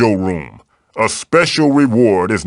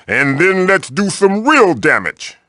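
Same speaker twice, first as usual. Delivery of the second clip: ordinary delivery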